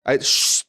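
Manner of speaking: a sharp unvoiced shush; librarian shushing whisper. Shown as a demonstration. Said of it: "I shushed them first".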